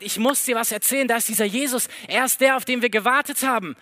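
eager, rushing retelling of the news; excited. Acted. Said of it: "Und er ist so begeistert".

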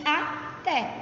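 "até" slowly split, a, pause, té; syllable split. Shown as a demonstration. Teacher checking oxytones with class, "até" broken in two.

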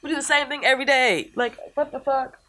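high-pitched, frustrated rant. Real, not acted. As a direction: vent your annoyance, voice up high. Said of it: "that's just my frustration".

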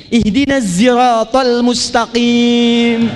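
siratal said as ziratal with a Z, slow; slow chant, Z sound in siratal; recitation with zai. Shown as a demonstration. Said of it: "Yang benar pakai zai".

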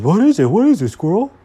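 loud, high, swooping cartoon voice; eager Alfred character. A narrator's reading. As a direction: demand it eagerly at high volume. Alfred demands news.

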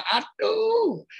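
high, drawn-out exasperated wail; exasperated groan. Acted. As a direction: act out being fed up, pitch way up. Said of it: "Kadang-kadang kita kesel banget".

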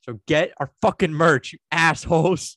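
loud, hammed-up scolding of the audience; mock-angry shout. Podcaster scolding listeners over poor merch sales, mock-angry shout. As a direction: berate them loudly for comic effect.

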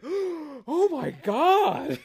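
gasp, then a high, slow exclamation; mock horrified realization. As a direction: draw it out in hammy shock. Acts character gasping in office.

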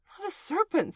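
high, loud indignant denial. A narrator's reading. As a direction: protest as if deeply insulted. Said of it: "said Alice indignantly".

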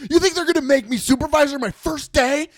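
high, loud mock yelling; hammed-up sarcastic outburst at full pitch; outraged retort. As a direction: imitate a worker screaming in disbelief.

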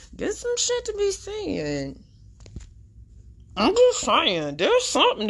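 sly loaded hint; pointed aside. Real, not acted. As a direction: drag it out meaningfully.

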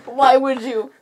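loud, pressing pretend questioning; mock accusation. Co-host grilling Bella in a bit, loud mock accusation.

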